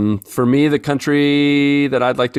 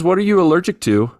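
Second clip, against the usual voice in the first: reading a listener question; 8 semitones higher, 3 dB louder than usual. Retold or imitated. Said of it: "he says".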